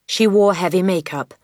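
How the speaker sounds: ordinary delivery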